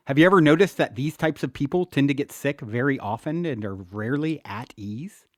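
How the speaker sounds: usual speaking voice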